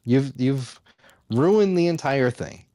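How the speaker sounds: ordinary talk, nothing marked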